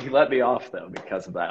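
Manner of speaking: usual speaking voice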